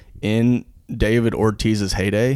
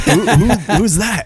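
high, louder voice through laughter; laughing Ortiz impression; 12 semitones higher, 4 dB louder than usual. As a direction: squeak out the confused question.

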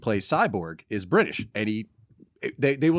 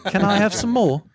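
orphan voice; 8 semitones higher than usual. Acted. Mashed-up impressions.

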